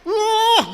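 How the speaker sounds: high, loud "wooh"; mock rage whoop. Preacher re-enacts choking his brother, whooping in mock fury.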